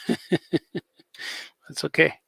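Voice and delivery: soft unvoiced laugh through the words. Real, not acted. Laughing off his friend's sympathy about his feet, softly laughing. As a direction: shrug it off with a breathy laugh.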